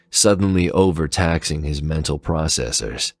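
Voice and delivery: ordinary delivery, nothing marked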